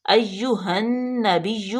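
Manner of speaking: "ایہا النبی" very slow, doubled نون pressed; drawn-out "ایہا النبی"; careful recitation. Shown as a demonstration. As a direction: model "ایہا النبی" slowly. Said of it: "اے یا پیش یو".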